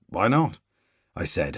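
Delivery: usual speaking voice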